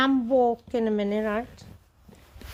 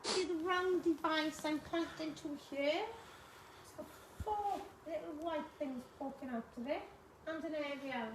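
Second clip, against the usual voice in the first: hushed; 17 dB quieter than usual. Real, not acted. Hushed explanation during ghost hunt.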